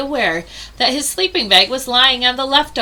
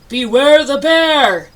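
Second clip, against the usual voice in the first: slow, louder spooky bear voice calling; growly bear call; 4 semitones higher, 7 dB louder, slower than usual. Acted. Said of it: "a great growly voice called out".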